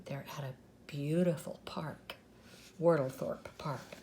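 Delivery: soft, low conspiratorial whisper. Acted. Storyteller whispers the truth about the park to the children. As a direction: let the children in on the secret.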